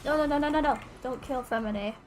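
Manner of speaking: ordinary delivery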